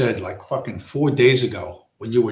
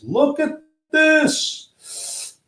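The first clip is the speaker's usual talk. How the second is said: "Look at this." very high and very slow; squeaky drawn-out look at this; much slower than usual. Pretend. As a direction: stretch "Look at this." into a squeaky marvel.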